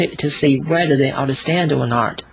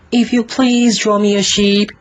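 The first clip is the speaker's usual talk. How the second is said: put-on high, louder small boy voice; little boy's voice; 7 semitones higher, 4 dB louder than usual. Little prince waking the pilot, high childlike plea. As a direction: ask politely like a tiny strange child. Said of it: "The voice said".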